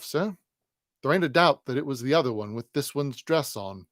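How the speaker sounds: loud, confident detective; brisk, pleased with himself; hearty Inspector Bucket. Acted. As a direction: Bucket reporting it all squared, loud and sure. Bucket reports to Tulkinghorn.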